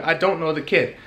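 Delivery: ordinary delivery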